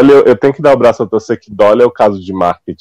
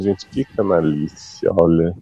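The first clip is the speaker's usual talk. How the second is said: softer, slow, appalled aside; muttered disbelief; 6 semitones lower, 9 dB quieter, much slower than usual. Retold or imitated. Recalling her reaction to the scandal, softer and slow. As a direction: hush it out in disbelief. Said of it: "Eu fiquei assim".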